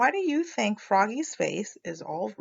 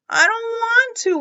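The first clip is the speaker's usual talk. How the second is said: much higher, louder whiny protest; loud whine; 14 semitones higher, 6 dB louder than usual. A narrator's reading. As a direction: wail it like a stubborn kid. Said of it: "whined Froggy".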